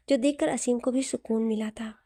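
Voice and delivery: ordinary delivery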